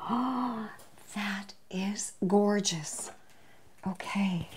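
slow, hushed admiration; awed whisper. Real, not acted. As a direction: whisper it in awe over the food. Admiring the sliced roast up close.

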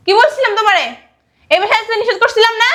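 loud, high, confrontational questioning; angry scolding. Acted. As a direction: tear into an unwelcome visitor. Angry scolding of unwelcome former in-law. (রেগে চড়া গলায় ধমক)

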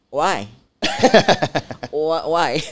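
a bemused why through laughter; laughing why. Retold or imitated. Replaying her confused reply to her coach, laughing. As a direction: laugh out the question.

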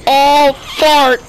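loud jump-scare exclamation; startled yelp. Real, not acted. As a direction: jolt at the scare.

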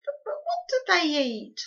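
high, loud wail. A narrator's reading. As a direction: stammer it out as a wail. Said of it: "wailed Homily".

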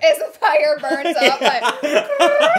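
laughing through the words; can't stop giggling. Real, not acted. Laughing over the blowtorch.

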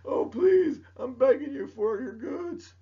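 high, pleading plea of a desperate country; hammed-up pleading for goods; mock begging. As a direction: beg dramatically, hamming it up. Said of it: "they're supposed to be like".

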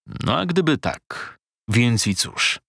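slow, unbothered reply; calm shrug. Acted. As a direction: shrug it off slowly. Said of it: "odparł". (spokojnie, powoli, obojętnie)

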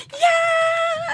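squealed high yeah; over-the-moon yeah; excited yeah. Retold or imitated. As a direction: gush it way up high. Mimicking own excited reply.

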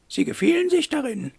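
high-pitched put-on lady's voice; pointed mademoiselle. A narrator's reading. Eloire accuses Wolf of self-satisfaction, high pointed lady voice. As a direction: confront him in a high character voice.

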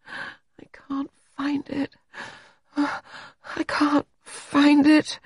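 high, drawn-out feverish moan; delirious moaning. A narrator's reading. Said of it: "John Seagrave continued to moan".

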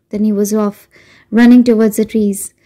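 ordinary delivery

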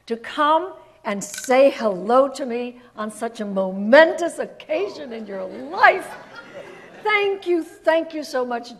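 loud, slow, gushing read of the letter; gushing diva note. Retold or imitated. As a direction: read it like a grand star gushing. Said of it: "the note said".

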